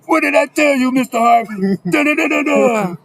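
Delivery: high, loud mock sermon; exultant preaching. Officer celebrating the not guilty verdict, exultant preaching. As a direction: whoop it up like a preacher. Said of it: "He started preaching".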